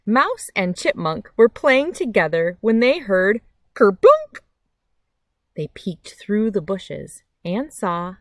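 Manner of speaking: lively picture-book reading with big pauses; reading to children. A narrator's reading. Reading a picture book to kids on a nature channel, lively storytime voice. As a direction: read it like storytime for little ones.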